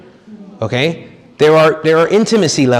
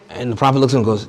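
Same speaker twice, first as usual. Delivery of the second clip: ordinary talk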